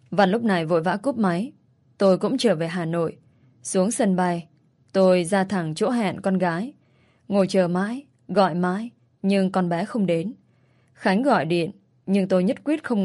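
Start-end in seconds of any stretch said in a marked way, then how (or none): none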